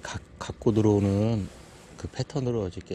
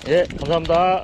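loud, higher thanks across the water; loud shouted thanks; calling thanks; 7 semitones higher, 8 dB louder than usual. Real, not acted. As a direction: call your thanks over to him.

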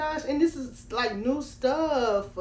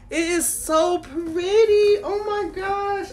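gushing; 7 semitones higher, 4 dB louder, slower than usual. Real, not acted. Admiring doll clothes on camera.

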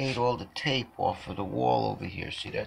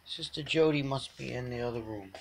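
normal talking voice

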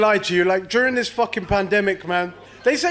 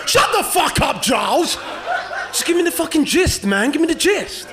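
fed-up yell at the friend rambling on; angry yell; 5 semitones higher than usual. Retold or imitated. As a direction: snap at him to get to the point. Yelling at friend Giles.

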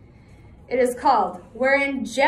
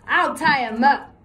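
loud, higher wheezy outlaw voice; wheezing; 4 semitones higher, 8 dB louder than usual. Acted. Cutwater answers his partner with a loud wheeze. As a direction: push the words out on a wheeze. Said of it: "Cutwater wheezed".

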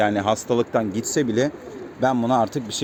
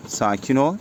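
usual speaking voice

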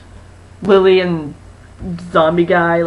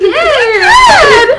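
excited squeal; 5 dB louder than usual. Retold or imitated. Friend echoes the excited quote.

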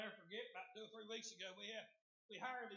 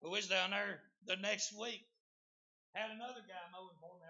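ordinary talk, nothing marked

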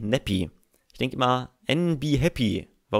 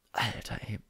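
whispered aside; 2 semitones lower, 9 dB quieter than usual. Real, not acted. Muttering after a game moment.

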